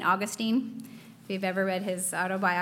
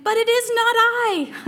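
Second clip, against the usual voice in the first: Augustine's reply; 13 semitones higher, 8 dB louder than usual. Retold or imitated. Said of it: "he turned around and said".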